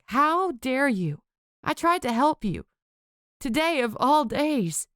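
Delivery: slow, high, wounded fury; shaking anger. Acted. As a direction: drag out each word in outrage. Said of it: "Her voice shook".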